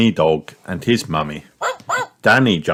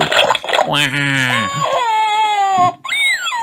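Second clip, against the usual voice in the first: put-on long, high-pitched toddler wail; wailing cry. A narrator's reading. George losing his dinosaur in mud, wailing. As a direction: wail on and on like a small child. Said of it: "George starts to cry".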